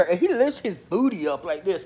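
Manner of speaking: normal talking voice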